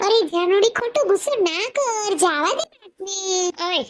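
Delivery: high, slow, near-tearful plea; whiny pleading husband. Acted. Husband begging his angry wife, high and tearful. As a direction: beg her, close to crying.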